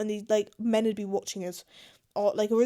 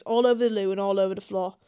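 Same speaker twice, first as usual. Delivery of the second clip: normal talking voice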